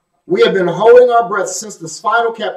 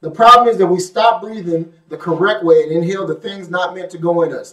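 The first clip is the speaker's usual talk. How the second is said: ordinary talk, nothing marked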